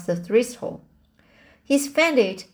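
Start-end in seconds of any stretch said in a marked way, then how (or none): 1.6-2.4 mildly urgent, higher-pitched exclamation; a light storybook gasp of alarm. A narrator's reading. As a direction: report it with a touch of shock. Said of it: "gasped Marilla".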